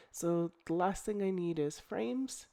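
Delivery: usual speaking voice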